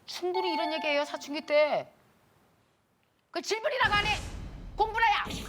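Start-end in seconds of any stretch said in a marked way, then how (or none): 3.3-5.4 high-pitched scolding mom, brushing off the question; 자존감도 나이를 붙여 snap back, 그 질문이라고 안 해 cut short with a high scolding tone; snapping mother. Retold or imitated. Mother brushing off her teen daughter, high-pitched scolding. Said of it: "그런 엄마 많을 텐데".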